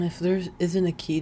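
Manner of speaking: normal talking voice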